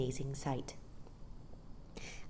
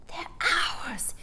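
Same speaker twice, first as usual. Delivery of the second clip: breathless; 12 semitones higher than usual. Acted. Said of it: "said Michael breathlessly".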